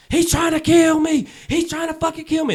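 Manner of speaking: high, frantic cry for help; panicked plea. Retold or imitated. Bleeding lady begging strangers for help, high and panicked.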